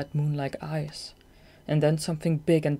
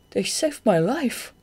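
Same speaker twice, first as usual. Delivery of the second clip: higher and louder, eager; excited; 7 semitones higher, 5 dB louder, slower than usual. A narrator's reading. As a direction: Shade telling Marina about his rescuers, excited. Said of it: "he said excitedly".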